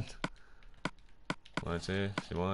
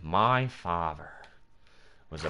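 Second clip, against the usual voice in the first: Heath Ledger; 6 semitones lower, 4 dB quieter than usual. Acted. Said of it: "Do the Heath Ledger voice".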